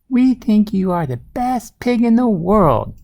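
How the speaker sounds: high-pitched fond praise for a pet; adoring couple. A narrator's reading. Farmer and wife praise their small pig, adoring. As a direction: voice the doting farmers, pitched well up.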